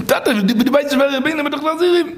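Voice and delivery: high-pitched plea to a father; desperate pleading. Acted. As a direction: beg father to recognise you, voice soaring. Son pleads with father who disowns him. (פארצווייפלט בעטן דעם טאטן, מיט א הויך קול)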